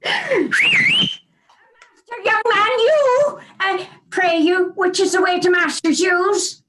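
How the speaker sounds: put-on old father lost, calling a stranger. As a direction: call out like a blind old man.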